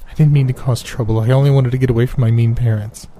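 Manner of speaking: put-on high, nervous voice of a scared girl; runaway girl nervously tells the stranger her name; jittery little girl. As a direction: say it timidly, like a frightened child. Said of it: "she said in a jittery voice".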